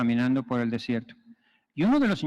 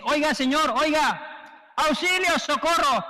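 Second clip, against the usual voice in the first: drowning man's plea; 10 semitones higher than usual. Retold or imitated. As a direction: beg for rescue from the water. Hombre en el río pide ayuda. (súplica aguda del hombre que se ahoga)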